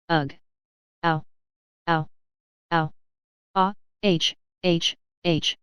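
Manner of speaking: spluttering ughs and ows that fade into breathy hs; choking cries and crowing. A narrator's reading. Fledgeby choking on the salt and snuff, crowing cries. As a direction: cough it up like he's choking on snuff. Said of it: "crowing fearfully".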